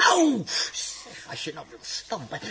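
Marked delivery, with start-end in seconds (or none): 0.0-0.4 high sharp cry of pain; pained yelp. Acted. Dirk stamped on, high yelp of pain. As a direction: yelp as the foot is hit.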